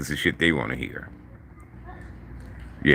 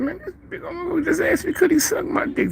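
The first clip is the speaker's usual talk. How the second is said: rushed, much higher voice, reliving the shock; shocked retelling; faster than usual. Retold or imitated. Telling the homies about the big man's proposition, shocked and high. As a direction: blurt it out fast and high like you still can't believe it.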